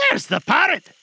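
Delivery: high, loud cry of discovery; excited cry. A narrator's reading. Scrooge spots Robin Crusoe's parrot, high excited cry. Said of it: "cried Scrooge".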